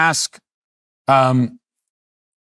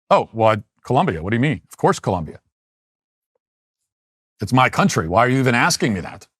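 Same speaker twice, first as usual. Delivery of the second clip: imitating her attitude; 2 semitones lower, faster than usual. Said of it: "That's, that's her attitude".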